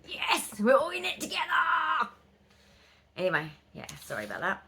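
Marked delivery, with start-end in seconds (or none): 0.0-2.1 high, cheering "we're all in it together"; excited cheer. Retold or imitated. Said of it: "And it's like".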